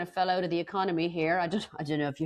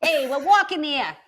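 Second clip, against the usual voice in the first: loud, high New York street shout; hammed-up New York accent, higher and louder; 9 semitones higher, 7 dB louder than usual. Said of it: "a nice New York accent".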